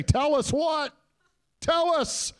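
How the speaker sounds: very high imitated crowd shout; imitated onlooker's high-pitched holler; calling out.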